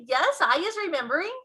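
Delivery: high, loud eager giant; high, loud giant's eager answer; excited BFG. A narrator's reading. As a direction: burst out with the memory. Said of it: "he cried".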